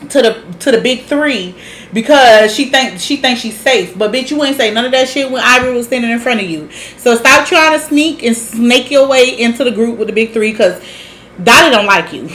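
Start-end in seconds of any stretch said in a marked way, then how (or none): none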